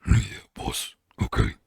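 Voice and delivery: soft, lightly voiced goblin; a hint of a meek goblin agreeing. Goblin Grick answers his boss, soft and meek. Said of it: "That's gonna be his voice from now on".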